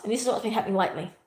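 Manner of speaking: ordinary talk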